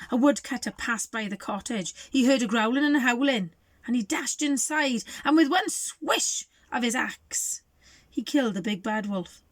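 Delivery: plain reading voice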